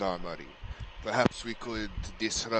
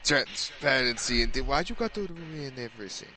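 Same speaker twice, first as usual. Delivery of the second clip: put-on Hibernian accent, complaining; 3 semitones higher than usual. Grumbling at a spoiler of theatrics, Hibernian accent. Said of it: "The Hibernian accent is very disconcerting".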